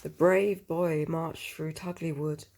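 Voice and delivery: ordinary delivery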